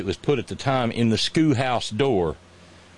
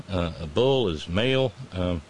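ordinary talk, nothing marked

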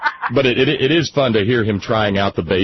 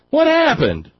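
much higher, put-on youngster's voice; imitating dismayed son; 4 dB louder, slower than usual. Dad retelling son's failed try at a high song, high mock dismay. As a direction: mimic your son's startled, high-pitched question. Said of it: "He went".

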